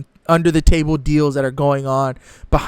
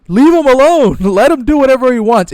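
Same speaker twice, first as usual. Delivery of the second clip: laughing, high and loud plea; amused loud plea; 7 semitones higher, 5 dB louder than usual. Real, not acted. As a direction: laugh it out, begging them to back off. Laughing plea to leave him alone.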